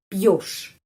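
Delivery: пьёшь said with a clear stressed yo, pyosh; careful Russian demo. Shown as a demonstration. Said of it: "Karena itu pengucapannya yo".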